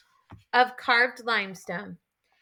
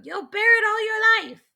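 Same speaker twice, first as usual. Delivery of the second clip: higher, louder proclamation; proud proclamation; 10 semitones higher, 5 dB louder than usual. A narrator's reading. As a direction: declare it to the whole tribe.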